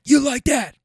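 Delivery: high, loud celebratory taunt; imitated athlete's hyped yell; Kirk Cousins. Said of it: "Kirk Cousins style".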